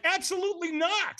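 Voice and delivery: very high-pitched mock refusal; hammed-up high-voiced no; emphatic refusal. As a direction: refuse in a squeaky, comic protest. Acted refusal of the fire walk.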